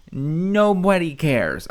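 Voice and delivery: drawn-out dismissive "nobody cares"; slow dismissive. Real, not acted. Host brushing off the streamer's stalker fears, slow and dismissive. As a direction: stretch it out, brushing her off.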